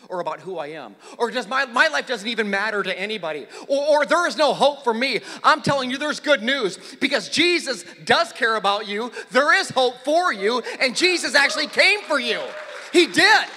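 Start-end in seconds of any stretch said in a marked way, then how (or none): 1.5-13.5 louder and higher, building to a cry; full-force preaching. Acted. To the congregation, preaching at full force, rising loud. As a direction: preach it out to the whole room.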